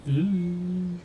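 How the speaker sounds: sudden high startled ooh; surprised ooh. Real, not acted. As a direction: caught off guard by a wobble. Plane wobbles on landing.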